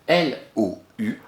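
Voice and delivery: "L O U" named slowly, one letter at a time; spelled letter by letter. Shown as a demonstration. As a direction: spell out "L O U" for a child. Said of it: "on a bien lou au début".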